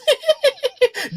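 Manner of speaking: high, loud ghoul cackle; cackling. Acted. Fallen ghoul laughing at its killer, high cackle. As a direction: manic high-pitched giggle as a monster. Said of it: "still laughing, and it says".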